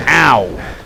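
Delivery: ordinary talk, nothing marked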